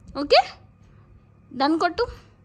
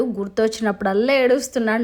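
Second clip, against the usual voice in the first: ordinary delivery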